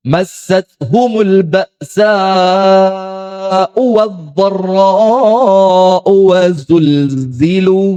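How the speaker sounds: slow, drawn-out chanted recitation; Quran recitation. Acted. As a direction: recite the verse slowly in tartil. Quran verse recited in sermon. (lantunan tilawah yang lambat)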